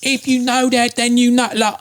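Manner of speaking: put-on high, lively British accent; Russell Brand impression. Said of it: "Russell Brand saying it".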